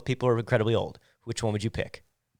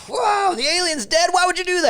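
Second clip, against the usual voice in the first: high mock voice. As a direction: squeal it out like a shocked kid.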